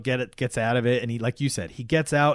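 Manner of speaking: normal talking voice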